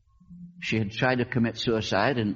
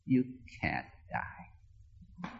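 very slow, soft and breathy; gently, almost a whisper; calm sweet voice; 10 dB quieter, much slower than usual. Retold or imitated. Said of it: "It was just calm and sweet and".

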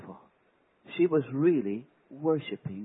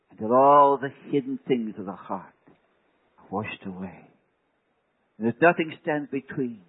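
fervent prayer; 3 semitones lower, 6 dB louder than usual. Real, not acted. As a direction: pray from the heart to Jesus. Shifts into prayer.

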